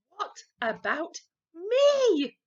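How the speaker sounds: high, slow, loud shriek; scream. A narrator's reading. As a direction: yell it out at full volume. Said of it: "screamed Henry".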